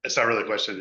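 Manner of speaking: voice that carries. Real, not acted. Neighbor at community meeting addressing panel, booming voice. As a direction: let the voice fill the room as it naturally does. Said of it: "I have a deep voice. My voice carries".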